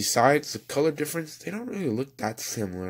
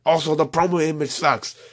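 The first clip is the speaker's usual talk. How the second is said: louder and higher, venting real annoyance; frustrated outburst; 5 semitones higher, 6 dB louder than usual. Real, not acted. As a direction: blurt it out, fed up with the promo. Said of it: "getting that frustration out".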